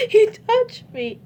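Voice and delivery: high, slow, tearful cry of he touched me; retold tearful plea. Telling her mom what happened, tearful and high. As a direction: relive the moment, voice cracking upward. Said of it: "I burst in tears crying, saying".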